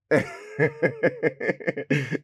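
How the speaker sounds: long bout of scornful laughing; incredulous laughter. Acted. Son hears his baby's name, bursts out laughing. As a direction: laugh at an absurd piece of news.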